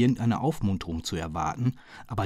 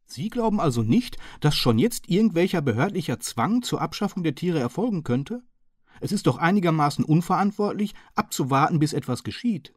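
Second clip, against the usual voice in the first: higher, louder, politely apologetic Hearson; bow and beg pardon with a raised voice; polite apologetic gentleman; 8 semitones higher, 4 dB louder than usual. A narrator's reading. Hearson apologising to the chief inspector, higher and politely.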